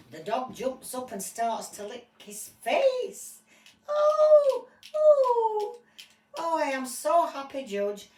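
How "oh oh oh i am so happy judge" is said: very high, loud cries of joy; overwhelmed with happiness; ecstatic reunion. Acted. Owner reunited with dog.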